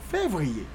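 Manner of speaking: février said as fev-ree-YAY; French pronunciation. Shown as a demonstration. Teacher giving learners February in French, février as fev-ree-yay. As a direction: model février the French way, same as Creole. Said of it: "And French".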